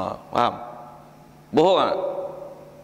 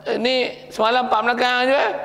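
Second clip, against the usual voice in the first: complaining buyer; 8 semitones higher than usual. Acted.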